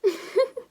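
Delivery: a short, bright chuckle; hearty laugh. Acted. Farmer laughing at the neighbour's weather worry. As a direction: laugh it off, amused.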